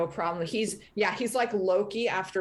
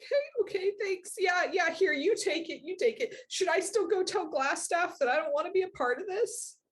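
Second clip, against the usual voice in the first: put-on eager kid; 10 semitones higher than usual. Kid accepting deal, handing cape.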